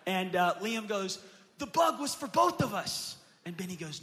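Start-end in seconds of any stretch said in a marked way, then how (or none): 1.6-3.2 put-on higher, louder child protest; protesting kid. Brother claiming the bug, higher kid protest. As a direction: insist like an indignant boy.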